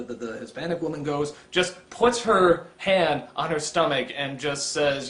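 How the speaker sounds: ordinary talk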